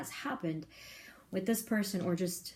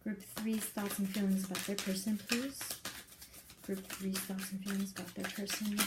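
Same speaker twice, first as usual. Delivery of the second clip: soft murmur; 7 dB quieter than usual. Acted. Repeated ritual question to Spirit.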